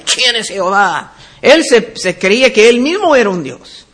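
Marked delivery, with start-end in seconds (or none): 0.0-1.1 imitando la arrogancia del faraón; arrogante. Acted. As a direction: pregunta con desdén fingido, como quien se cree un dios. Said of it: "Mira la, la arrogancia". (con arrogancia actuada)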